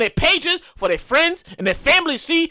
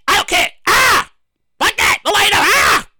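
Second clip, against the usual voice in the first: louder, higher indignant yelling; mock angry shout; 5 semitones higher, 3 dB louder than usual. Son to his smoking dad, indignant shouts. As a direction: blow up at him over the cigarette.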